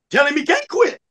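high-pitched retold objection; insistent jail lady. Jail lady to guard about quitter, insistent.

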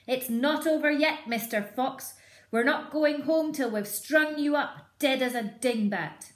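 high, loud threatening shout. Acted. Bean threatening the fox below, loud shout. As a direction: roar threats down the hole. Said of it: "shouting down the hole".

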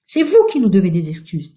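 loud, indignant retort; angry. A narrator's reading. Virginia demanding apologies from the ghost, angry. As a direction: demand it hotly. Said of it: "dit Virginia en colère".

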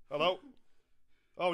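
ordinary talk, nothing marked

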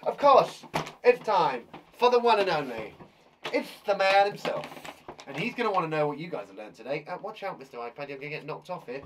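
normal talking voice

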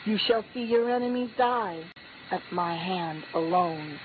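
normal talking voice

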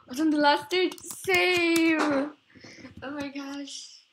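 high, loud cry of dismay; dismayed outburst. Real, not acted. Player who lost the last stage, loud dismay. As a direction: wail the last word out long.

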